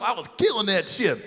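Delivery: normal talking voice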